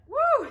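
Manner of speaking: high, loud woo; celebratory squeal of a whoop; excited whoop. Real, not acted. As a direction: let out a short high-pitched "woo". Excited over new bottle.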